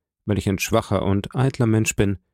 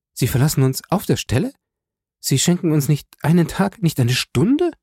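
hohe, drängende Fragen mit steigendem Ende; bestürzter Protest; 7 semitones higher than usual. A narrator's reading. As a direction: flehentlich nachhaken. Julie protestiert gegen die Abreise. (ungläubige Schwester, deutlich höher)

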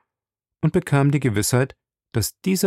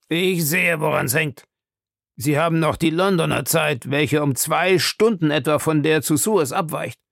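higher-pitched, instructive agent; explaining Fix; 6 semitones higher than usual. Acted. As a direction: patiently set the traveller straight. Voices Fix explaining the time.

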